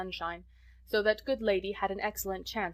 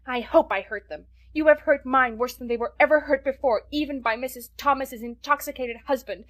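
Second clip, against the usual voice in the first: building to much louder, higher shouting; furious tirade; 4 semitones higher, 7 dB louder than usual. A narrator's reading. Child's furious tirade at neighbour.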